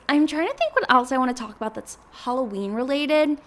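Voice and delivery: normal talking voice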